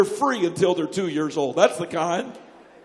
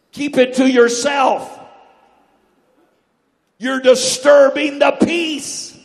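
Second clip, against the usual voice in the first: loud, slow scolding; mock scolding; 5 semitones higher, 10 dB louder, much slower than usual. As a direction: shush them harshly. People scolding prayer.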